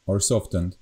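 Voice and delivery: ordinary talk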